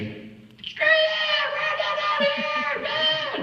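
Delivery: very high screamed retort; hammed-up shrieking quarrel; imitated argument. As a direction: screech it back like a furious co-star. We really got into an argument.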